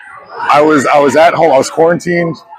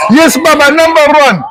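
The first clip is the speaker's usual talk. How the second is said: high-pitched, booming wrestler catchphrase; Iron Sheik; 4 dB louder than usual. Acted. Actor introducing himself as his wrestling character, hammed-up voice. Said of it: "the Iron Sheik from Young Rock".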